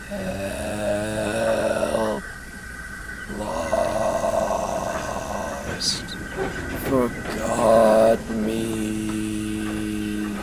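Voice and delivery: soft, drowsy murmur, very slow; sleep-talking. Acted. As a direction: mumble it in your sleep. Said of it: "She's sleep-talking".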